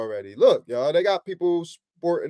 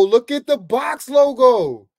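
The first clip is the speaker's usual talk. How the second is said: excited shout; 6 semitones higher, 5 dB louder, slower than usual. Real, not acted. Astonished showing the shirt.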